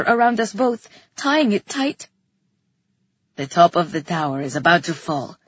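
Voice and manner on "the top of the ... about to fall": much lower-pitched character voice; deep-voiced urgent warning; Theron's voice. A narrator's reading. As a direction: drop well down in pitch for a grave warning.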